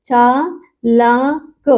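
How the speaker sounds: चालाक stretched very slowly, every sound held long; drawn-out reading. Shown as a demonstration. Teacher slowly sounds out चालाक for her students. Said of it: "ये क्या होता है जानते हो?".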